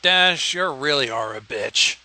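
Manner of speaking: ordinary talk, nothing marked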